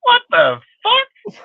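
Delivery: high-pitched, stretched-out "what the fuck" in disbelief; cracked, drawn-out cry of disbelief; retold disbelief. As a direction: squeal it slowly, as if you can't believe it. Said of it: "I was like".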